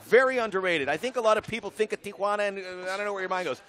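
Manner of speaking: upbeat, fast promo read. Acted. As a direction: sell Tijuana like a travel ad. Reads fighter's Tijuana promo.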